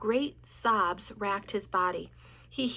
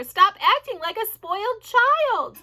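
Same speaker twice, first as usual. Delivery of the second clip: high, loud and slow; screaming; 11 semitones higher, 9 dB louder, slower than usual. A narrator's reading. Wilbur screaming at Templeton, high and loud. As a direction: scold him at the top of your voice. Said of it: "screamed Wilbur".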